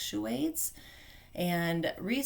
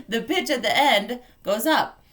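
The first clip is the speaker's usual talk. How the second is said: laughing through the words, loud and higher; amused; 6 semitones higher, 12 dB louder than usual. Real, not acted. Coach amused at husband's reply, laughing loudly.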